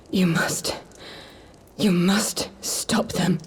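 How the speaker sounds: slow, faint whisper, begging; pleading whisper. Acted. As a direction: whisper it slowly, with the last of her strength. Poisoned sister, weak, bedridden.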